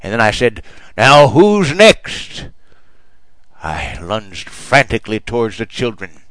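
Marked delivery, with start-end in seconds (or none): 1.0-2.5 very slow, high and loud villain taunt; menacing taunt. Retold or imitated. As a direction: stretch it out to terrify the kids. Monster priest taunting terrified children.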